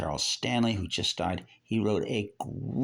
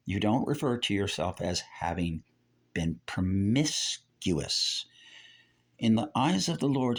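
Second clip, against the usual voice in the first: normal talking voice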